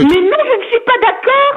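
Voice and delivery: high, drawn-out indignant protest; real indignation, stretching the words; exasperated objection. Real, not acted. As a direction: object slowly, voice pitched right up. Objects to words put in mouth.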